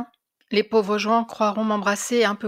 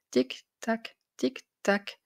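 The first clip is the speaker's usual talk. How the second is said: clock ticking; 6 dB quieter, much slower than usual. A narrator's reading.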